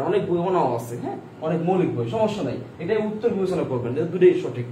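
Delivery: ordinary delivery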